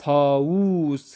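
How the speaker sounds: طاووس drawn out slowly; teacher stretches طاووس for the class; slow model word. Shown as a demonstration. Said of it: "تو مور کو ہم فارسی میں کیا پڑھیں گے؟".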